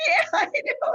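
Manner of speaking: laughing high through the words. Real, not acted. Host laughing at guest's chat remark, laughing reply. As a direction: giggle it out.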